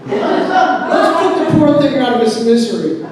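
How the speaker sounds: high, loud, hammed-up proposal; father's loud declaration. Father coming in with carving knife, loud declaration. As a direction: announce it boldly as the dad.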